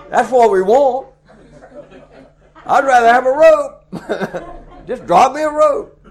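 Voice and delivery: loud, high, laughing through the words. Real, not acted. Preacher laughing with the congregation about wanting a rope. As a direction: chuckle it out, amused at himself.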